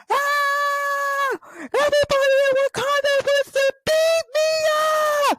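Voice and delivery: high, fast terrified cry; panicked scream. Retold or imitated. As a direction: yelp as the mob closes in. Zemo beaten by crowd.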